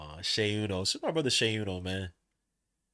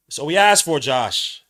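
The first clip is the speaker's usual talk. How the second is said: repeated much louder, punching asked; 3 semitones higher, 12 dB louder, slower than usual. Shown as a demonstration. Streamer repeating the coach's point, much louder. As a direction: say it again, hard and loud.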